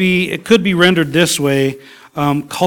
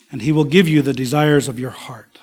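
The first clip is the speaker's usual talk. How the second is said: whispered promise. Acted. Preacher whispering God's promise to the congregation.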